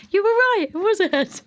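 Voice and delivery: high, loud cheerful concession; excited admission. Retold or imitated. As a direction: laugh it off, happily owning up. Midwife conceding after delivery.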